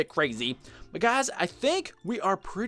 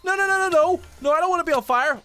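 high, loud panicked yelling; panicked shout; 8 semitones higher, 3 dB louder, faster than usual. Real, not acted. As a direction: yell in real panic, you're burning. Set on fire in game.

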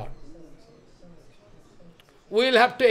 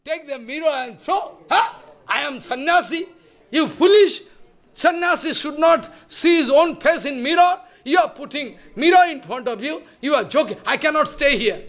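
high-pitched indignant rebuke, louder in bursts; acted anger; 6 semitones higher, 4 dB louder than usual. Monk scolds disciple for holding up a mirror, acted anger. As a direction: scold in mock outrage, voice raised high. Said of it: "Prabhu became very angry".

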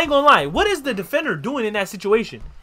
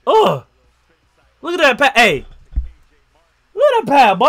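ordinary delivery

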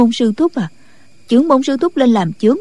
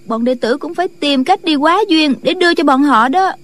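higher-pitched junior explaining eagerly; earnest disciple; 5 semitones higher than usual. Acted. Disciple recounts paying for news of the leader, higher-pitched. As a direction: tell it in a raised, lively disciple voice. Voices the disciple Trịnh Ngạc.

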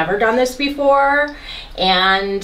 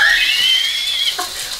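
nervous laugh. Real, not acted. Nervous about blowing house up.

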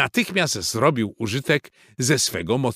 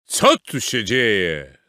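slow, loud, puzzled question; horse character asking; 5 dB louder, slower than usual. A narrator's reading. Horse arriving amid the brawl, asking slowly. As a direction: draw it out like a big wise horse. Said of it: "zapytał".

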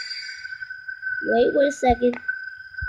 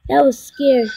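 ordinary talk

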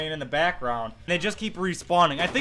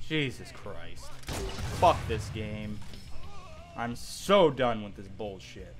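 defeated mutter; 9 semitones lower, 8 dB quieter, slower than usual. Real, not acted. Weary giving up.

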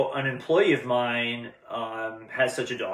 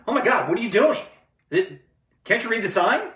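zookeeper's alarm; 10 semitones higher, 4 dB louder than usual. Retold or imitated. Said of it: "The zookeeper ran over and said".

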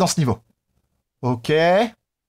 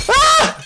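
high, loud "ah" scream; startled scream; 5 dB louder than usual. Real, not acted. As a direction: let out a piercing, high yelp. Scream after failing to break glass.

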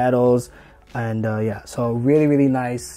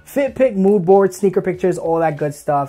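hyped plug; 4 semitones higher, 7 dB louder than usual. Real, not acted.

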